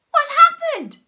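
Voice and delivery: very high, loud screamed question; piercing storybook scream. A narrator's reading. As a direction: shriek it in shock. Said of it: "screamed Missus Twit".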